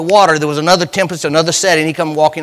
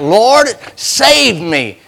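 slow drawn-out cry for help; cried out; 6 semitones higher, 3 dB louder, much slower than usual. Retold or imitated. Peter sinking, crying to the Lord, drawn out slowly. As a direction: stretch out a desperate plea. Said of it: "he cried out".

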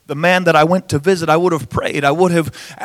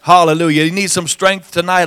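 usual speaking voice

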